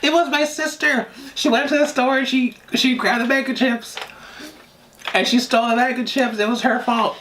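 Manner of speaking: fake-sob to blame the sister; mock crying. Caught by grandma, wailing that sister did it. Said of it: "break down and start crying".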